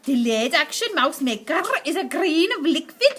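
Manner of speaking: lightly voiced witch lecturing her audience; a hint of a commanding old witch explaining; Grand High Witch voice. Witch explains her plan to the audience, commanding.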